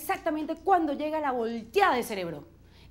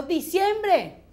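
high, slow, drawn-out announcement; triumphant reveal; 4 semitones higher, 4 dB louder, much slower than usual. Real, not acted. Comedian reveals the party month, high drawn-out proclamation. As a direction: stretch the punchline out with glee.

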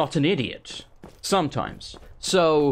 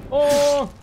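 alarmed cry; 11 semitones higher, 5 dB louder than usual. Real, not acted. Hit hard in a fight.

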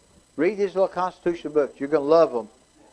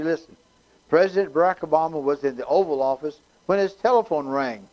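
normal talking voice

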